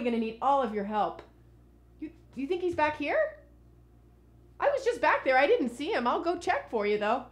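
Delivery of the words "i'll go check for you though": ordinary talk, nothing marked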